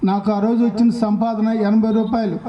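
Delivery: ordinary talk, nothing marked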